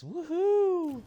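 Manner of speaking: high joyful woohoo; excited whoop. Real, not acted. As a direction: whoop with delight. Streamer cheers at new destination.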